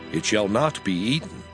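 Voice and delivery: ordinary delivery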